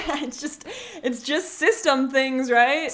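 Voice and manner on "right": ordinary talk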